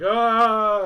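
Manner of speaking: high, loud imitated panicked "ah"; mock freak-out yell. Guardian freaking out over his summoner, mock panicked yell. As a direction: let out a hammed-up yell. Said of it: "Waka's kinda freaking out".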